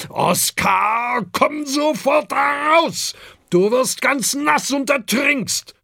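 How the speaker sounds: high, loud, worried command; Herbert shouting. A narrator's reading. Big dragon Herbert orders Oskar out of the sea, shouting. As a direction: yell him out of the water. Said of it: "ruft der große Herbert".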